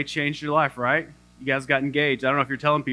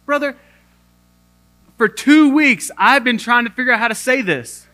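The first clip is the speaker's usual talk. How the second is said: higher and louder, bursting out; exasperated relief; 9 semitones higher, 7 dB louder than usual. Retold or imitated. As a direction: finally spill it after two weeks. Relieved outburst to pharmacist.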